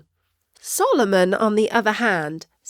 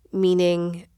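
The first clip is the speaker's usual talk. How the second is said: ordinary delivery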